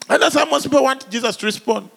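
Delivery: usual speaking voice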